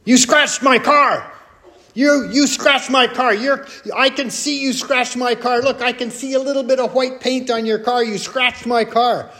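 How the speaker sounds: high-pitched retold accusation, insistent; mock yelling. Lady runs up to wife over a scratched car, mock yelling. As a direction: act out an outraged car owner yelling. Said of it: "this yelling match".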